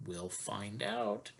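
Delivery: hushed. Real, not acted. Reader echoes in hushed voice.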